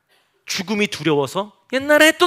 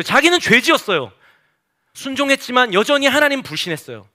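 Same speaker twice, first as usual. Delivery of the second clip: usual speaking voice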